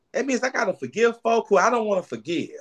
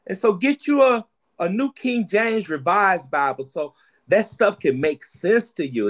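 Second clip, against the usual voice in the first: ordinary talk, nothing marked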